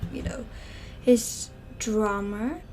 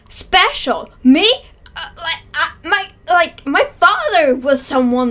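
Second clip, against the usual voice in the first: higher, louder, offended disbelief; indignant surprise; 5 semitones higher, 11 dB louder than usual. Acted. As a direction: bristle at the insult, voice up. Boy offended at being called ordinary.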